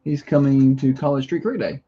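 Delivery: ordinary delivery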